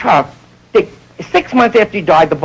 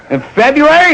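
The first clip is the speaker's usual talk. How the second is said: pitched up in mock disbelief; high incredulous question; 10 semitones higher than usual. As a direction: ask it high, as if it can't be true. Comic retort in dialogue.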